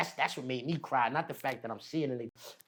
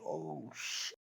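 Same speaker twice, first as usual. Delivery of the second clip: "Oh, shit" whispered, softer, no voice; hushed shock; 10 dB quieter than usual. Retold or imitated. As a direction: breathe "Oh, shit" in stunned shock. Retold shock, whispered take.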